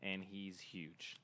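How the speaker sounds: very slow, low and soft shrug; slow deadpan. Real, not acted. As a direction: drag it out dismissively. Joking dismissal.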